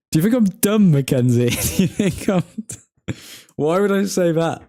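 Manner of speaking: high, laughing disbelief; amused indignation, pitched well up; incredulous. Real, not acted. Streamer scoffing at a question about their local team, incredulous. Mock-offended reply to a giveaway question.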